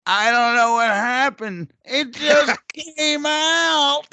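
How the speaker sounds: put-on sheepish drunk, laughing it out; drunk dishwasher voice.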